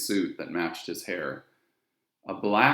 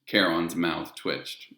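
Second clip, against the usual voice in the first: ordinary delivery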